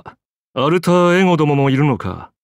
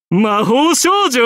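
high, drawn-out disbelief; incredulous question; 9 semitones higher, much slower than usual. Acted. Scoffing at a woman as magical girl, incredulous. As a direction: stretch it upward as if unable to believe it.

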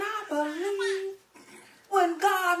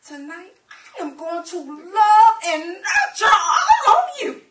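loud, rising shout to the room; big stage shout of love; shouted declaration; 11 dB louder than usual. Acted. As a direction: proclaim it to everyone. Wife shouting to the crowd.

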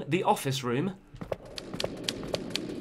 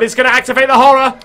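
panicked; 7 semitones higher, 7 dB louder, much faster than usual. Real, not acted. Panic in horror game.

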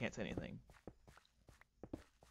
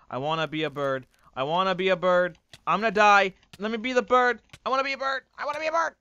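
building from plain to much higher, louder, frantic; panicked pleading; 8 semitones higher, 9 dB louder, faster than usual. Real, not acted. Streamer drowning in game, pleading frantically to turn back. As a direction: beg louder and higher each time.

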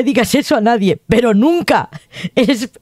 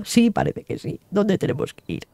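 quiet; 2 semitones lower, 8 dB quieter than usual. Acted. Otro personaje responde bajo.

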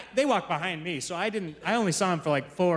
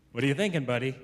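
gentle dad question; 6 semitones lower, faster than usual. Retold or imitated. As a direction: ask your little boy kindly. Dad gently asking son.

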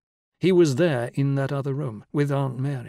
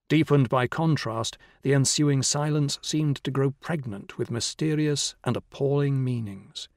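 plain reading voice

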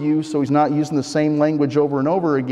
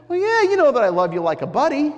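higher-pitched, quick, offhand reply; casual brush-off; 7 semitones higher, faster than usual. Acted. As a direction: toss it off like buddy talk. Peter's casual answer, acted.

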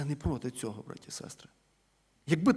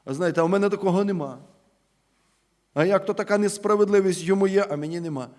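louder, fast mock complaint of an envious person; envious grumble; 5 semitones higher, 7 dB louder than usual. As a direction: play the jealous neighbour, aggrieved and quick. Preacher voices an envious person. (репродукція заздрісної людини, голосніше і швидше)

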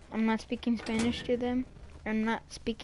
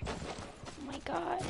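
hushed; 5 semitones higher, 12 dB quieter, much slower than usual. Pretend. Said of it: "I'm so scared".

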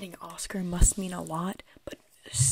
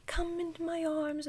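high coaxing voice; sing-song coaxing; 8 semitones higher than usual. Retold or imitated. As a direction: coax the hamster sweetly. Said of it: "It was like".

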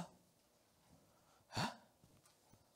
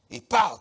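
loud mock sound effect; 3 semitones higher, 10 dB louder than usual. Sound of the slash.

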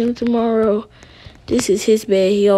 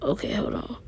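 whisper; 10 dB quieter than usual. Real, not acted. Sneaking, not waking son.

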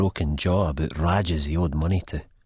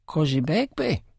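Spud voice; 11 semitones higher than usual. A narrator's reading. Spud's quoted question.

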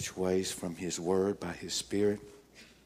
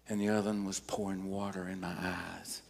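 normal talking voice

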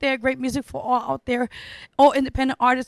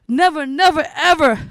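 louder on each never; emphatic; 7 dB louder than usual. Real, not acted. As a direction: punch every never, louder. Pleading artists never to quit.

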